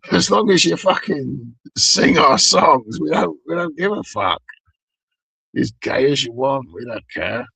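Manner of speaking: rowdy metal fans. Retold or imitated. Shouting back at the singer as his fans. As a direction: bellow it like a crowd of metal fans. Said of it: "his fans are like".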